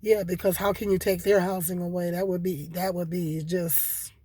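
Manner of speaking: ordinary talk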